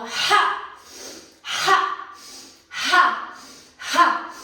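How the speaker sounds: sharp loud "Ha!" exhalations; forceful breath shouts. Shown as a demonstration. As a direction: punch each breath out with a shout. Breath of fire exercise.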